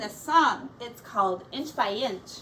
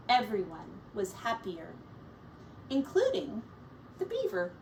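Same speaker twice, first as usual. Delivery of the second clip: story narration. A narrator's reading. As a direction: read it like a picture book for kids.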